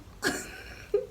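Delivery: a sudden high-pitched laugh; squeaky amused laugh. Real, not acted. Reacting to own body count remark.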